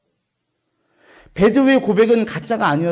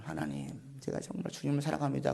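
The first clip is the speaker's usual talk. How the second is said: much softer, hushed heartfelt confession; soft prayerful confession; 4 semitones lower, 16 dB quieter than usual. Pretend. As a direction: confess it quietly, like a private prayer. Confessing love to God in prayer.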